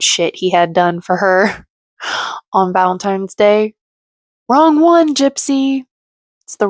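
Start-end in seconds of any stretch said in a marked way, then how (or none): 4.5-5.8 mocking. Real, not acted. Mocking the show's subject.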